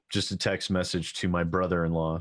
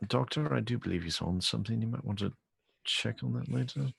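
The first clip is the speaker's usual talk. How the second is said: quiet aside; 5 dB quieter than usual. Acted. Leans over, confides to doctor.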